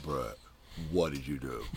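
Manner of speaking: hushed imitation of the coach; mild ominous murmur; mock dread. Expected angry phone call.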